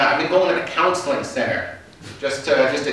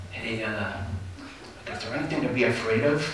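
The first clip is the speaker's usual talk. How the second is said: quiet, nervous question leaned close; nervous hush; 5 semitones lower, 11 dB quieter than usual. Retold or imitated. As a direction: ask it under your breath, nervous. Leans over to stranger whispering.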